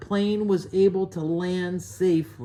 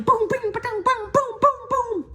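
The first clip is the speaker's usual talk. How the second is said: very high, monotone boom-bing noises; sing-song high-pitched ricochet noises; high pinging sound effects. A narrator's reading. Imitating seeds pinging off the plane.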